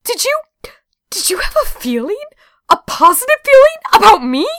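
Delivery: very high, hammed-up gasping disbelief; squeaky-high teasing alarm; taunting mock shock. A narrator's reading. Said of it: "at the familiar taunting".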